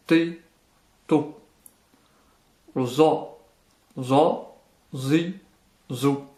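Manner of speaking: "so, do, to, zo" heavily pronounced with a darker tone; weight each syllable like "so" and "zo", darkened and accentuated. Shown as a demonstration. Teacher showing the emphatic letters, heavily accentuated and deep. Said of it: "leur intonation est beaucoup plus grave".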